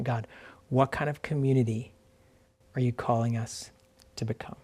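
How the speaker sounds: softly spoken prayer, broken by pauses; quiet prayerful question. Real, not acted. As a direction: let the question to God sink to a hush. Prayerful question repeated to God.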